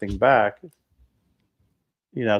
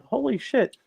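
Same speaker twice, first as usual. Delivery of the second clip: disbelief; 13 semitones higher, slower than usual. Retold or imitated. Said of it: "And I'm like".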